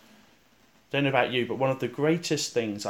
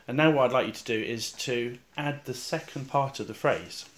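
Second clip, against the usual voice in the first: ordinary talk, nothing marked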